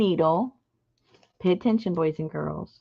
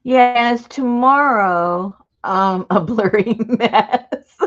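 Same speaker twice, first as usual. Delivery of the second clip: slow, louder with a laugh; 2 semitones higher, 6 dB louder, slower than usual. Real, not acted. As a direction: laugh at the blurry mess. Laughing about blurry video.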